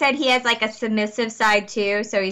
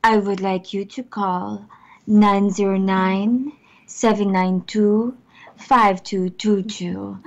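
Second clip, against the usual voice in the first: slow sexy Russian accent on the number; low, slow Russian-accented come-on; Russian spy accent; 3 semitones lower, slower than usual. Shown as a demonstration. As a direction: read the digits as a seductive Russian spy. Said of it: "I want to sound like a sexy Russian spy".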